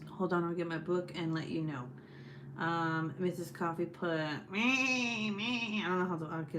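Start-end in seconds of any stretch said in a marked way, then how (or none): 4.5-5.8 bad SpongeBob impression. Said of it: "That was a terrible SpongeBob impersonation".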